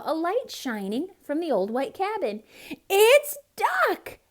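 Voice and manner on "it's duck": very high, loud joyful shriek. A narrator's reading. Cat and squirrel spotting duck at home, shrieking. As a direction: shriek it as you burst in. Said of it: "they shrieked".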